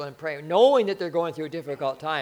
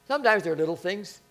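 ordinary delivery

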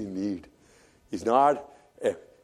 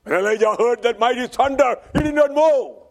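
raised, ringing preacher's voice; preaching at full force; 12 semitones higher, 8 dB louder than usual. Acted. Preacher retelling Elijah's flight to the congregation, preaching at full force. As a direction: proclaim it to the congregation.

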